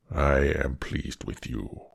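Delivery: low, slow beast voice; a monster well pleased. Acted. Beast tells Beauty he is pleased, low and slow.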